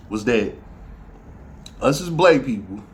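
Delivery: usual speaking voice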